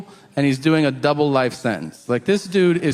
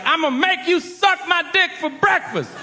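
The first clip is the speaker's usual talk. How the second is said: high, strained mock yell; over-the-top pitched-up threat; threatening prisoner. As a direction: menace a kid in a hammed-up, high inmate voice. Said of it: "he's talking ridiculous shit".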